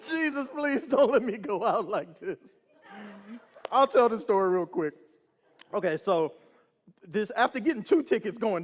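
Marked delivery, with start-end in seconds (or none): 0.1-2.4 higher mock-pleading prayer; hammed-up frightened prayer; comic desperate plea. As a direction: plead for your life, played for laughs. Comic desperate prayer on being pulled over.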